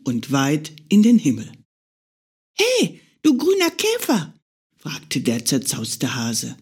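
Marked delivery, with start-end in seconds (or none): 2.5-4.3 high, friendly bunny call; a small hare hailing a beetle; calling little hare. Acted. Hare calls the green beetle, high and friendly.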